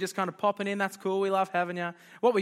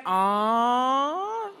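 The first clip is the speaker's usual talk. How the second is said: mock cooing; 5 semitones higher, 4 dB louder than usual. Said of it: "everyone said".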